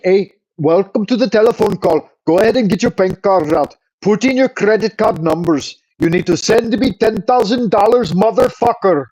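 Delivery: put-on Indian accent. Streamer mimicking a phone scammer, mock Indian accent. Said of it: "they talk like".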